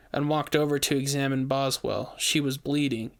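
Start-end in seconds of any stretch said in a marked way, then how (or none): none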